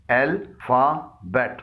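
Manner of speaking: अल्फाबेट stretched slowly into three syllables. Shown as a demonstration. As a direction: tap out अल्फाबेट slowly, one beat each. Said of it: "इसके भी तीन हिस्से हैं".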